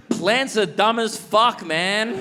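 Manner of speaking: high, drawn-out indignant complaint; drunken rant. Acted. Drunk man arguing intensely with a plant, indignant.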